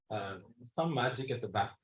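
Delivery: normal talking voice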